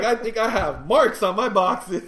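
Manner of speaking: high, loud mock confession; laughing punchline. Passenger joking about his fright, laughing mock confession. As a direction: blurt it out through laughter.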